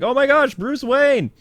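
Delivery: high-pitched imitation of fawning crowd; mock starstruck. Everybody greeting Bruce Wayne on the boat, mock gushing. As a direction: squeal it like an adoring crowd. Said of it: "And everybody's like".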